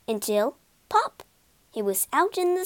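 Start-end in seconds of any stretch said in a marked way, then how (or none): 0.9-1.2 short, high-pitched pop; a little high burst; sound-effect word. A narrator's reading. Narrator acts out the pop.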